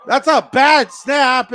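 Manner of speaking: punched-out exclamation at a live mishap; excited play-by-play call. Real, not acted. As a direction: call the botched play with sudden alarm. Live call of botched snap.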